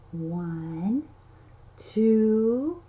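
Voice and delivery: ordinary talk, nothing marked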